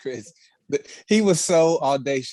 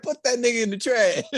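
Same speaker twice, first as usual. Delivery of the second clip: laughing quote; 9 semitones higher than usual. Retold or imitated. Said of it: "She said".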